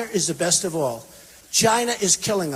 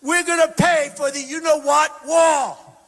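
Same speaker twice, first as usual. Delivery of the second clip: slow, shouted, high mocking rant; a loud, slow, over-the-top imitation of an outraged official; mocking angry quote; 7 semitones higher, 4 dB louder, slower than usual. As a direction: ham up the indignant refusal, slow and loud. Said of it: "So he is saying".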